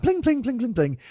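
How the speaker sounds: high sing-song plucking noises; imitated string plucking. She shows off her new instrument, mimicked in high plinks. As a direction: mimic clumsy plucking high, dropping at the end.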